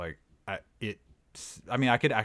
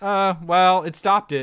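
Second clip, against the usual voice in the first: higher, louder imitation of someone excusing a botch; mock defensive excuse; 7 semitones higher, 9 dB louder, slower than usual. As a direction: put on a raised, loud defensive voice. Acting out caulkers' defensive excuse.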